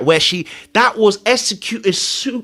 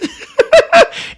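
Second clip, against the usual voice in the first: high laugh; 5 dB louder than usual. Real, not acted. Laughing at clip.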